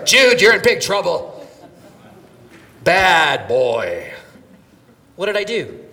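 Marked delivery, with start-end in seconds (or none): 0.0-4.1 mock scolding. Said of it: "Did I go".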